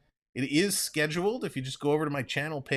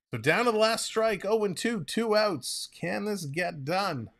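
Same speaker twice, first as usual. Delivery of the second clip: tense excitement; 7 semitones higher than usual. Real, not acted. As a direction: build the tension of the last strike. Last strike, two outs.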